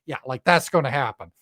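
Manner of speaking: normal talking voice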